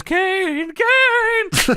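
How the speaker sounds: high falsetto call; Paul Bearer. Acted. Wrestling manager calls out for Kane, high falsetto wail. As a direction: wail the name in a mock manager voice. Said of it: "Paul Bearer says".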